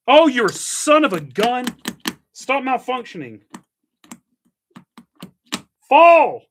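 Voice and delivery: high, loud scolding of the machine; exasperated shout. Real, not acted. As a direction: yell at it to just fall. Cursing the stuck device.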